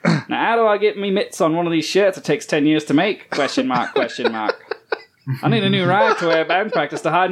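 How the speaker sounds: hammed-up Australian reading voice; Australian accent. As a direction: read it in a broad Australian accent. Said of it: "read this in your best Australian accent".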